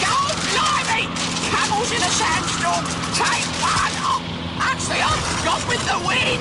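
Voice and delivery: high, strained shout over wind; excited calling out. Acted. Pig directing camels in a sandstorm, excited shouting. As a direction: call directions over a roaring storm.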